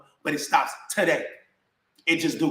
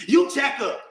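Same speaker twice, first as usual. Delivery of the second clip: higher-pitched mock retort; retold retort; 6 semitones higher, slower than usual. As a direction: throw it straight back, pitch up. Mother's retort in retold argument.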